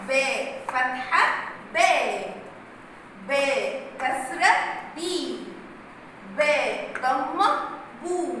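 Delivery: slow, drawn-out teacher drill of باء فتحة باء; slow classroom phonics drill; drilling letter sounds. Shown as a demonstration. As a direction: spell out باء, بي, بو syllable by syllable. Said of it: "تعالوا كده نراجع تاني حرف الباء".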